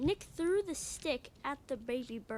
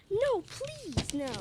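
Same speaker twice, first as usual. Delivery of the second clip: pleading; 8 semitones higher, slower than usual. Acted.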